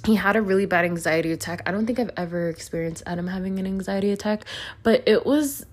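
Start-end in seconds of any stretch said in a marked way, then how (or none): none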